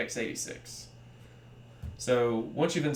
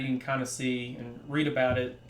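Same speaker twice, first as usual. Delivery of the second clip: ordinary talk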